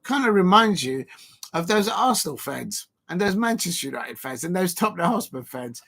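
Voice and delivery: ordinary talk, nothing marked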